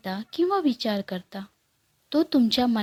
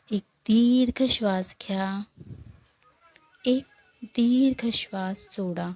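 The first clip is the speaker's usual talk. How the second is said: guided meditation. Acted. Guide leading listeners through morning breathing meditation, calm and unhurried. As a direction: guide them gently into stillness, leaving long pauses.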